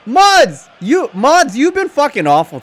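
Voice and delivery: high, loud call to the mods; calling out shout. Real, not acted. Streamer scolding his chat moderators, loud call-out.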